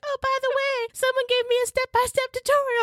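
very high, sing-out imitation of a friend; exaggerated high-pitched "by the way" voice; mock breezy friend. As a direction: toss it off in a put-on squeaky-high voice. Mimicking friend's breezy afterthought in story.

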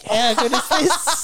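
high, slow, giggling delight; laughing. Retold or imitated. Stoned friends eating free chicken.

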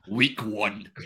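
slow, hammed-up battle cry; mock-dramatic proclamation. Host teasing fans who stake all on week one, mock-dramatic proclamation. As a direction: declare it like a do-or-die vow, in jest.

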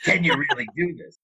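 put-on a doubting Staten Island boss accent. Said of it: "in her thick Staten Island accent".

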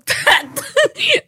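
loud high laugh; high burst of laughter. Real, not acted. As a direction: crack up. Laughing at insult.

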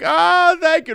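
high-pitched grateful sigh of thanks; gushing relief. Retold or imitated. Rescued driver thanking her helper, imitated in a high gushing voice. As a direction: imitate a relieved woman, voice shot way up.

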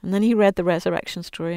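ordinary talk, nothing marked

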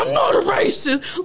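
high, slow mock protest; imitating mad boss. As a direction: act out an angry man insisting. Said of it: "he was mad".

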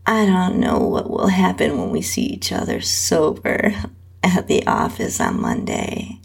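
ordinary delivery

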